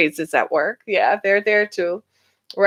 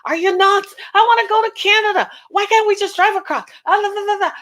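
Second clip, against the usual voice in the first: much higher, indignant complaining; mock outraged citizen asking about Canada, much higher; mock outrage; 8 semitones higher than usual. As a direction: play an outraged traveller. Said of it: "So it's gonna be like".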